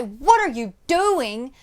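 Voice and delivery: the right way: strong but not screamed; contained anger. Shown as a demonstration. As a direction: hold the fury in and bite the consonants. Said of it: "When we say".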